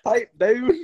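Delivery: high-pitched horse outburst; talking horse. Retold or imitated. Storyteller replays the horse's interruption, hammed-up outburst. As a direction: exclaim it as the horse, half laughing. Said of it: "this horse is just like".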